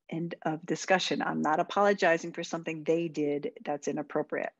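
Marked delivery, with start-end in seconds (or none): none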